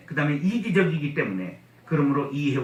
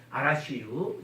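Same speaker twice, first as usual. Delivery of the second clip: Chungcheong dialect; 2 semitones lower, 5 dB quieter, slower than usual. Retold or imitated. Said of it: "충청도 사람들이 이런 걸 잘해요".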